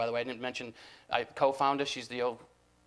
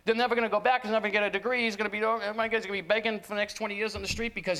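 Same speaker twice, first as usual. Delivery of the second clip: high-pitched, louder spiral of parental panic; mock alarmed parent listing disasters; panicked parent; 8 semitones higher, 4 dB louder than usual. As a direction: spill it out like a parent sure the kid is doomed. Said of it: "parents immediately go".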